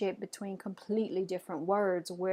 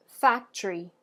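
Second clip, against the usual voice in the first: "factory" with FAC slightly louder; first-syllable stress; 5 dB louder than usual. Shown as a demonstration. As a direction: lean on the opening syllable of "factory". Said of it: "the first syllable is slightly emphasized".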